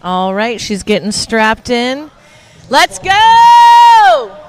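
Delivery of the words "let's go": high excited yell; cheering shout. Real, not acted. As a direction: hype the crowd up. Cheering lift start.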